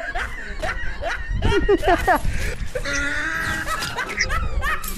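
high, breaking laughter that keeps going; long laughing fit. Real, not acted. Gamer cracking up after an elimination, long high laugh.

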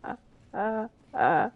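ordinary talk

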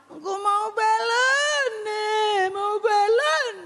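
high, slow sobbing demand; crying child. Pretend. Child sobbing to mother that he wants a balloon. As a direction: whine through tears for the balloon.

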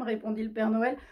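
ordinary delivery

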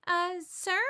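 unsure, asking politely; a hint of a shy schoolgirl; hesitant question. A narrator's reading. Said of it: "Marcy says after a while".

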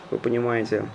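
normal talking voice

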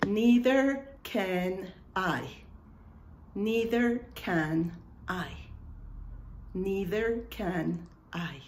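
Neither can I stretched very slowly; slow classroom model; slow teaching demo. Shown as a demonstration. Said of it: "Y ahí está".

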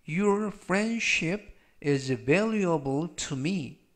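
"A vegetarian doesn't eat meat" read very slowly, word by word; slow model reading. Shown as a demonstration. Teacher reads the example sentence slowly to students. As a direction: spell out each word slowly. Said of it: "즉, 전체적 의미는".

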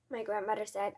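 plain reading voice

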